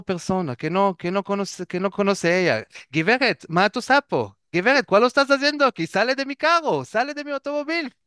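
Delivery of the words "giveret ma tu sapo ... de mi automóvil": higher, fast, outraged driver telling her off; a mock-angry car owner chasing her out; indignant stranger. Stranger scolds woman in his car.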